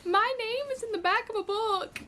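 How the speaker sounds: high, cracking with emotion; overwhelmed disbelief. Real, not acted. Reader finding her name in the acknowledgements, overwhelmed. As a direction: marvel at it through tears.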